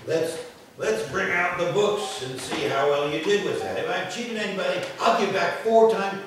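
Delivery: high, loud eager offer; eager Zacchaeus. Acted. Preacher voicing Zacchaeus offering to repay, high and loud. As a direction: open the books boldly.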